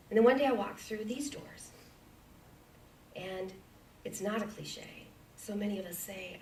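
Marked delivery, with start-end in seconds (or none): none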